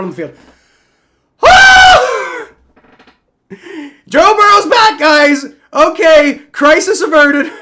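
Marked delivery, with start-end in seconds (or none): 1.4-2.5 a huge high relieved whooping laugh; ecstatic whoop. Real, not acted. Fan whooping as the injured quarterback returns, ecstatic. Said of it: "can you guys tell I'm traumatized?". 4.1-7.5 much higher and louder, near-crying relief; relieved cheering. Real, not acted. Fan telling the viewers the quarterback is back, overjoyed shouting. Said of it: "can you guys tell I'm traumatized?".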